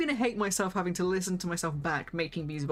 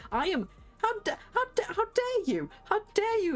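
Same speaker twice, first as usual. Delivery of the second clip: much higher, cracking outrage; mock offended; 12 semitones higher than usual. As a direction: play it hurt, the voice breaking upward. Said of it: "Offended so much I can hear the voice cracks".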